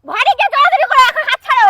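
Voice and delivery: angry shout. Acted. Staged quarrel over marriage.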